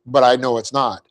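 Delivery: ordinary talk, nothing marked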